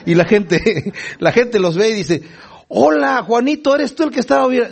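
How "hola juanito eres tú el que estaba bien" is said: high-pitched, comic voicing of an astonished neighbour; surprised greeting. Townspeople seeing the healed blind man, playfully surprised. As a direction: imitate a surprised acquaintance, pitched up.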